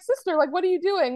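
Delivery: playful re-enactment of someone catching a snooper; mock-indignant Fred. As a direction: voice a lightly acted, surprised question. Recaps Fred catching Sister.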